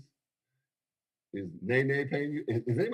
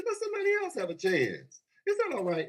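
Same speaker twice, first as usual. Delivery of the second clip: emphatic high call; 12 semitones higher than usual. Real, not acted. Preacher-like emphatic lift.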